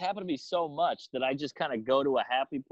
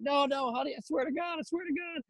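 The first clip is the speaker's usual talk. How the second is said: high, panicky mock pleading; pleading husband; 12 semitones higher, 3 dB quieter than usual. Husband begging his furious wife to believe him. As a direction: beg like a caught cheater.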